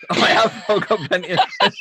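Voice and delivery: fast, laughing through the words. Real, not acted. Joking reply to friends, laughing fast. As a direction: crack up while insisting.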